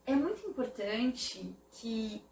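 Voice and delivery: ordinary talk, nothing marked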